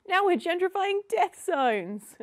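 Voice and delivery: high, loud, drawn-out exasperation; slow it down and raise it in mock disbelief; hammed-up indignant punchline; mock outrage.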